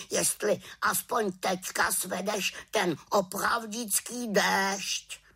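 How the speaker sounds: high, nagging bird voice; reproachful raven. A narrator's reading. Raven lists the witch's failed spells, reproachful croak. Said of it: "krákal vyčítavě".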